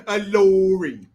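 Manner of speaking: loud, long drawn-out British "lorry"; mock British accent. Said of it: "It's just funny to say".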